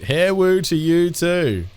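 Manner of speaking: "woo" drawn out slowly as in "Hair woo"; mock tts accent. Streamer repeating the tts "Hair woo" slowly. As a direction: say "Hair woo" with a slow stretched woo.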